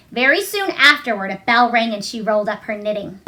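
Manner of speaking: ordinary delivery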